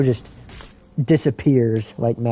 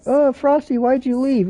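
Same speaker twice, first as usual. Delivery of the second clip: put-on much higher, pleading child voice; little girl voice; 11 semitones higher than usual. Little girl asking Frosty why he left, high childlike plea. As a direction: plead like a small girl missing her friend. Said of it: "the little girl's like".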